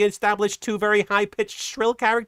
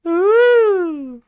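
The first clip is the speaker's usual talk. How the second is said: loud, high mock ooh; high cartoon ooh; 10 semitones higher, 8 dB louder than usual. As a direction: copy a swooning cartoon ooh. Mimics the clip's ooh.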